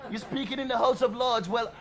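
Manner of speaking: ordinary delivery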